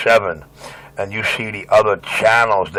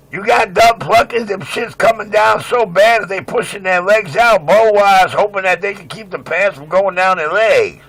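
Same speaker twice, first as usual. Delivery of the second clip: high-pitched rant; 10 semitones higher than usual. Real, not acted. Mocking rant, much higher.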